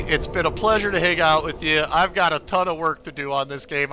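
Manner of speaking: ordinary talk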